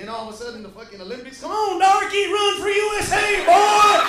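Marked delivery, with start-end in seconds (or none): 1.4-4.0 loud jeering fan yell, higher; hammed-up stadium shout; mock cheering shout. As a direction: bellow it like a rowdy fan. Mock Olympic fan yelling at runner.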